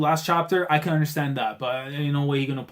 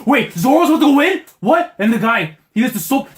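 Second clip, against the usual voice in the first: much higher, louder, screaming with hype; ecstatic shouting; 9 semitones higher, 7 dB louder than usual. Real, not acted. Fan watching Zoro join the fight, screaming with joy. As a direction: lose it completely with excitement.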